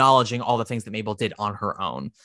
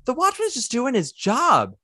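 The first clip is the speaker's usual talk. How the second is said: raised, fast, incredulous appeal; exasperated pleading; 9 semitones higher than usual. Retold or imitated. Pleading with Mac to leave the polite watchman alone. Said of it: "And I'm like".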